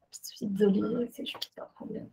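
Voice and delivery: very soft, trailing almost to nothing; apologetic aside. Real, not acted. Student excusing a problem mid-presentation, hushed apologetic aside. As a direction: mumble an apology under your breath.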